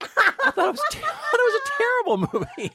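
high, loud laugh through the words; laughing repeat. Real, not acted. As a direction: giggle your way through it. Laughing while repeating verdict.

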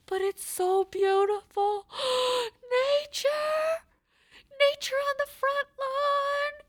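breathy awe with a gasp; gushing over the deer. Retold or imitated. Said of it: "but I'm like".

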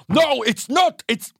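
a dad losing his English in anger; flustered dad impression. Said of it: "he'd be like".

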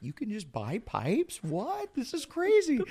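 high-pitched disbelieving tourist; wide-eyed amazement. Retold or imitated. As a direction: squeak it out in naive shock. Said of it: "being like".

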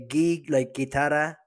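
"Gi" with the hard g of "guitarra"; demonstrated pronunciation. Shown as a demonstration. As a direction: sound out "Gi" as at the start of "guitarra". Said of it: "like the way you say guitarra".

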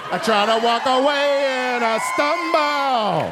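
lightly raspy, slow, high [name] mumble. Retold or imitated. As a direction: drift off like a spaced-out singer.

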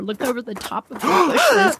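ordinary delivery

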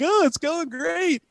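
slow high mock-cheery sarcasm; sarcastic. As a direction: drag it out, not fooled. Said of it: "I was like".

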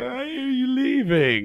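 high, slow mock plea; a whiny high put-on plea; imitating her. Co-host imitating her asking why he left, high and drawn out. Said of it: "That's what she would have finished with".

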